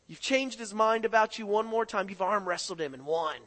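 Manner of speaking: loud, high, driving preacher voice; a preacher building to a roar over repentance; preaching at full force. Acted. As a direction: preach it hard to the congregation.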